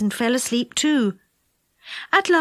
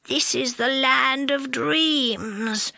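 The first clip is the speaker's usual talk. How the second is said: slow, soft, half asleep; sleepy; 3 dB quieter, much slower than usual. A narrator's reading. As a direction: drift off mid-sentence, very drowsy. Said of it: "said Moonface sleepily".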